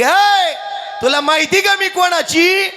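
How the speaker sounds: high, forceful challenge; indignant wife. Acted. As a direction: shout him down, pitch climbing. Jijabai देवावर खेकसते. (चिडून, चढ्या आवाजात)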